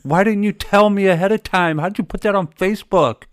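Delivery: high, loud, panicked disbelief; husband loudly and frantically demanding answers, high and fast; frantic questioning. Retold or imitated. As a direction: fire questions at your wife in a panic. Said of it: "I call my wife".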